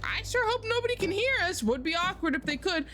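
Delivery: high, singsong mock concern; mock worry. Joking with a coworker about being overheard, high mock worry. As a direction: pitch it up as a playful quote.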